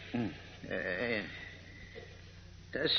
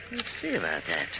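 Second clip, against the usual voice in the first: quiet menace; 9 semitones lower, 5 dB quieter than usual. Acted. Villain muttering a vow alone.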